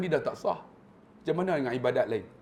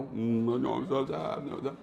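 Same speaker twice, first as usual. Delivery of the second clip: rueful murmur; 5 dB quieter than usual. Retold or imitated. Pilgrim admitting his sins.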